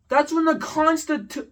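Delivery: high, loud outraged correction; can't believe what you heard; exasperated rant. Real, not acted. Ranting to viewers about a classmate, loud and outraged.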